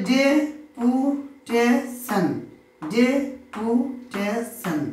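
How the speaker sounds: "Deputation" very slowly as ডে-পু-টে-শন; four separated beats in "deputation", pausing after each; split into four syllables. Shown as a demonstration. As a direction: sound out "deputation" one syllable at a time. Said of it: "চারবারের প্রচেষ্টায় উচ্চারিত করছি".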